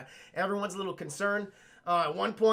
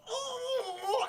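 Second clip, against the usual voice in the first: high, softer wordless moans; tongueless moaning; 4 dB quieter, slower than usual. Retold or imitated. Eyeless man with his tongue ripped out, mock moaning. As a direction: imitate moaning without a tongue.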